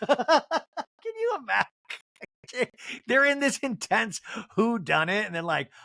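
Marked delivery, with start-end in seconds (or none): 0.0-2.7 cracking up, high and helpless; real laughter. Real, not acted. Host amused by the bread demand, laughing hard. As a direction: squeeze the words out through laughter.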